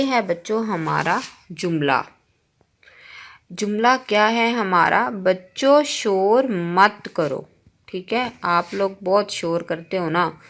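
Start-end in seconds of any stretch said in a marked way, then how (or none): 5.1-7.5 slow sentence reading. Shown as a demonstration. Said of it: "teacher reads the model sentence".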